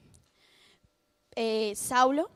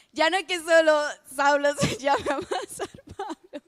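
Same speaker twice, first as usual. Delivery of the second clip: giggling through the line, can't keep a straight face; breaking into giggles on Saulo; laughing; 3 dB louder than usual. Real, not acted. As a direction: laugh as the words come out after a failed joke. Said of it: "Qué mala soy dando chistes".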